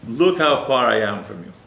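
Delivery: lightly acted calling out; retold crying out. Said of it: "cry out and call out to him".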